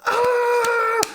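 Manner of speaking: sudden, high-pitched cheer of joy; excited shout. Retold or imitated. Friend Andy in the theater yelling at the hammer pickup, high excited cry. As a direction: yell like the hammer just got lifted. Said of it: "This was my friend Andy".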